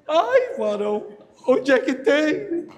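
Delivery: slow, high laughing disbelief; mocking laugh. Real, not acted. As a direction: laugh at the absurd bargain. Zomba da oferta absurda. (risada incrédula, lenta e aguda)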